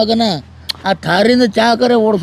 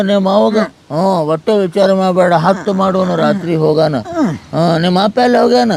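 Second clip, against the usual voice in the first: usual speaking voice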